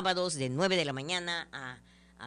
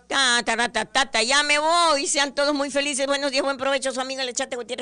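higher, louder cheerful host farewell; radio sign-off; 6 semitones higher, 5 dB louder than usual. Acted. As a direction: sign off the show brightly.

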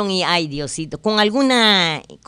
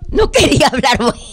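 laughing apology; 7 semitones higher, 5 dB louder than usual. Real, not acted. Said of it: "yo hablo muy fuerte".

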